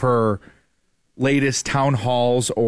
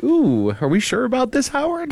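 high, breathy doubting lady voice; skeptical lady; 12 semitones higher than usual. Retold or imitated. Host voicing the skeptical woman behind Howard Dean, high and doubtful. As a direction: voice the doubting woman in the clip, pitched way up.

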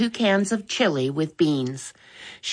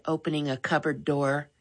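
ordinary delivery

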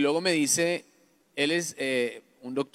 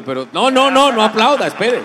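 much louder and higher, holding off the clapping; loud stop; 8 semitones higher, 12 dB louder than usual. Real, not acted. Speaker halting audience applause mid-story, loud. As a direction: cut the applause short with a raised voice.

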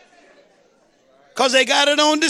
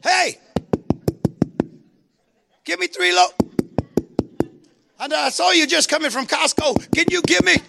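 dramatic midnight caller; 3 semitones higher than usual. Acted. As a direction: play up a pestering friend begging at midnight, pausing between pleas. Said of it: "be dramatic here".

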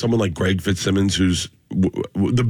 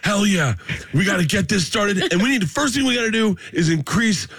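excited comics; 9 semitones higher than usual. Retold or imitated. Comics voiced as excited bros.

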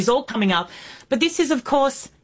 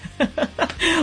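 short high-pitched laugh; delighted laugh; 7 semitones higher than usual. Real, not acted. Host laughing at a caller's compliment, delighted laugh. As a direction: laugh in pleased surprise, high and light.

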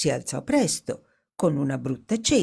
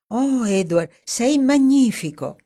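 higher and louder, thrilled; admiring; 8 semitones higher, 5 dB louder than usual. Acted. Dorothy praising Edward's daring, excited and louder. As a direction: gush with delight at him.

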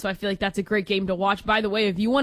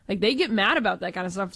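ordinary talk

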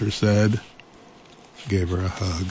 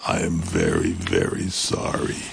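sheepish monster. Acted. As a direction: rumble a small shamefaced apology. Monster apologizes.

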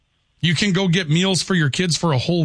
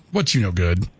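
put-on Southern voice, pitched down; Southern accent; 8 semitones lower than usual. Shown as a demonstration. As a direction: drop low into a Southern greeting. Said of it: "talk with my Southern accent".

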